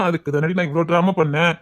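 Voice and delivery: scheming man's low chuckle; sly villain. Acted. Villain drops act, low laugh.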